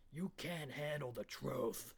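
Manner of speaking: slow, drawn-out American film line; movie quote impression. Comedian redoing the original film line, slow and heavy. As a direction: intone it like the original star. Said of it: "quintessential movie quotes".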